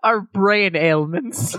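put-on slow old-timey huckster voice; snake oil salesman. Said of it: "I said that like, uh, nineteen hundreds, snake oil salesman".

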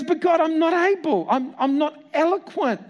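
high, slow pleading that cracks into a sob; high tearful whimper of a reluctant man; whining excuses. Retold or imitated. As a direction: Moses begging off, voice breaking. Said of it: "And he goes".

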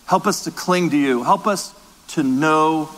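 hushed and reverent, speaking to God; closing prayer. Acted.

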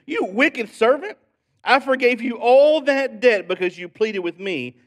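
loud, high scolding of a servant; angry rebuke. Acted. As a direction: read it as an outraged master. Reads master rebuking the servant.

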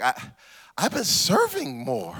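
normal talking voice

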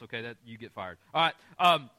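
ordinary delivery

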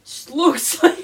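loud, high disbelieving scoff; mock the silly name in disbelief; incredulous. Real, not acted. Mocking friend's made-up show name.

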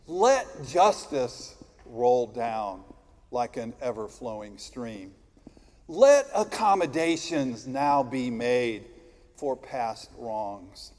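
slow solemn litany; preaching. Acted. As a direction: intone it like a prayer. Liturgical litany in sermon.

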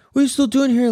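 mock high-pitched demand; retold indignant challenge. Girlfriend confronting him on his return, mock indignant.

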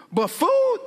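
high, surprised but food; incredulous scoff. Retold or imitated. Widow to prophet, incredulous high scoff. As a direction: scoff at the idea, voice jumping up.

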